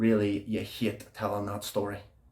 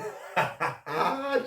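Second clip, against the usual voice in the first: giddy laugh; 6 semitones higher, 4 dB quieter than usual. Acted. Laugh after singing.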